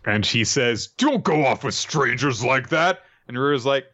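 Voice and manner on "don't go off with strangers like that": higher, slow put-on scolding voice; acted fussy reprimand, pitched up; mock scolding. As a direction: tell her off in a put-on voice.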